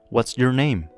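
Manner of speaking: normal talking voice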